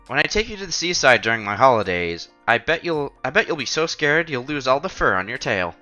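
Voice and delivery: ordinary delivery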